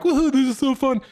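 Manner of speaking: high, loud mock woohoo; sarcastic cheer. Dragged along bridge jumping, sarcastic cheer. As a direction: fake excitement while secretly mad. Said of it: "I'm so mad".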